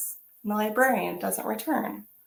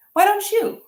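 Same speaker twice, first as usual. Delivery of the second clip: bright encouraging; 10 semitones higher, 7 dB louder than usual. Acted. Librarian encouraging Anna.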